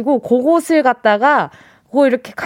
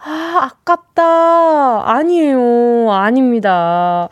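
very slow, sighing near-miss; playful long-drawn letdown; drawn-out regret; much slower than usual. Pretend. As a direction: stretch out the disappointment, falling away. Lets down a near-miss guess.

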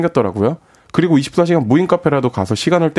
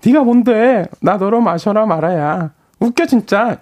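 put-on high, sassy tipsy girl voice; girl's voice; 9 semitones higher, slower than usual. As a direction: play a tipsy girl scoffing. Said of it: "다시 할게요".

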